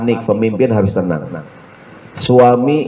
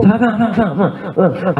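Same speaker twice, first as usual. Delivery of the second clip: 1 frantic husband; 9 semitones higher, faster than usual. Retold or imitated. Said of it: "dia ngomong".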